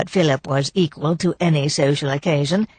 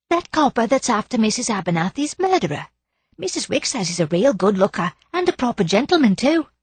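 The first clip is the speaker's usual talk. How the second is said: high, chatty Cockney char voice; gossipy charwoman; 6 semitones higher than usual. Acted. Mrs Potter gossiping to Daisy, high chatty char voice. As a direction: gossip eagerly like an old daily help.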